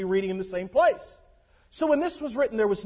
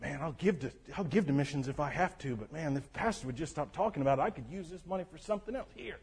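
low, much softer, sulky grumbling about money; grudging tither; 4 semitones lower, 9 dB quieter than usual. Retold or imitated. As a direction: gripe under the breath while handing over the money. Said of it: "my people at my church".

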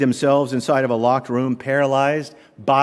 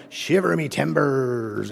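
slow, softer mock pirate growl; pirate talk; 4 dB quieter, slower than usual. Preacher mimics pirates talking at work, mock pirate accent. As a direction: drawl it like a stage pirate. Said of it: "that's how pirates talk".

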